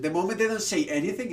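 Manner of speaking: ordinary talk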